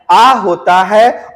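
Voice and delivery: "आ" held long and slow; stretched aa. Shown as a demonstration. Teacher modelling the long vowel for students. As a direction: draw out "आ होता है" slowly. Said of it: "प्लुत स्वर का उच्चारण दोस्तों आ".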